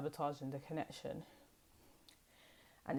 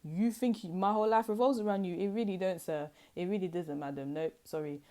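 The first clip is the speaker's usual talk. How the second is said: dismissive retort; 6 semitones higher, 3 dB louder than usual. Acted. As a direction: act out not caring one bit. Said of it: "because you're just like".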